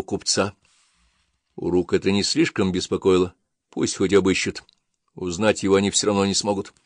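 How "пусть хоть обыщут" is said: plain reading voice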